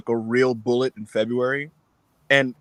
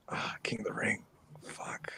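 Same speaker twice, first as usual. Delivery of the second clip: whispered, dismayed self-quote; deflated whisper; 15 dB quieter than usual. Retold or imitated. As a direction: let it out as a whispered curse. Retelling his dismayed reaction to calendar.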